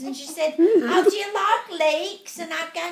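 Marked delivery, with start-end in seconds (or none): 0.6-2.4 higher, louder mock English lady; English accent. English lady asking her seatmate on the flight, imitated English accent. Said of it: "we're talking like this the whole time".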